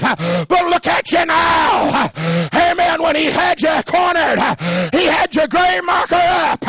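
preaching at full force. Acted. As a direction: preach it out to the whole church at full voice. Preacher shouting testimony of survival.

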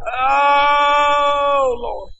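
long, high wailed "Oh"; crying out to God. Real, not acted. Preacher calling on the Lord, long wailing cry. Cries out in prayer.

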